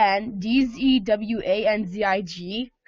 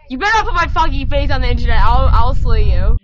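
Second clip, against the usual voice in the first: loud mock threat; 11 dB louder than usual. Real, not acted. Warning her friend off filming, a loud mock threat. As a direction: bellow a playful threat at the camera.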